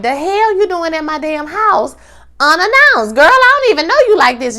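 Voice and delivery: hammed-up indignant sass; mock outrage. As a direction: play Hen calling out an unwanted visitor. Hen confronting Sydney, voiced.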